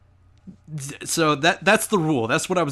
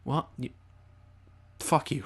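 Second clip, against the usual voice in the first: deflated retort; 9 dB quieter than usual. Acted. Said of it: "you're just like".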